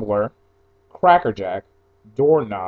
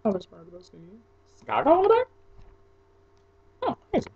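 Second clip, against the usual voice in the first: put-on switching accents clumsily; 10 semitones higher, faster than usual. Reading mock magazine lines aloud.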